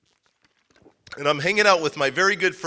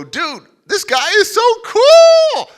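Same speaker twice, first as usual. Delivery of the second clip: very high, loud, drawn-out squeal; awestruck gushing; 14 dB louder, slower than usual. Retold or imitated. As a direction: shriek like a starstruck fan, stretching the last word. Retells awestruck gushing about his opponent.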